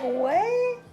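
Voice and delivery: high-pitched, eager mock outburst; eager inner voice. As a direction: jump at the invitation in a high voice. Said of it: "I was thinking that in my head".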